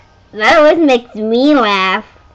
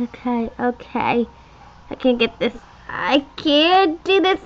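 usual speaking voice

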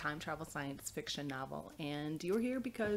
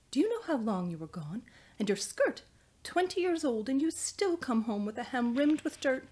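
higher, louder, exasperated motherly reproach; indignant, fussing mother's telling-off; scolding mother; 6 semitones higher, 5 dB louder than usual. Acted. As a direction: scold a grown daughter over her state. Mother scolds daughter in story.

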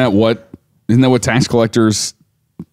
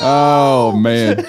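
ordinary talk, nothing marked

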